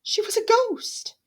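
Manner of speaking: ordinary delivery, nothing marked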